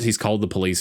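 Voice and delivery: ordinary talk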